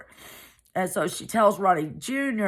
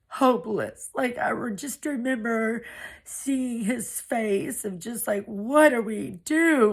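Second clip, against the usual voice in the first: higher, strained with grief; barely holding back tears; near tears; 7 semitones higher than usual. Real, not acted. Narrator recalls her hopeless brother, voice rising with held-back tears.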